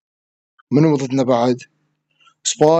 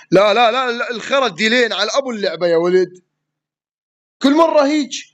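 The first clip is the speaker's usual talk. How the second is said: exasperated; 7 semitones higher than usual. Real, not acted. Losing a round, exasperated.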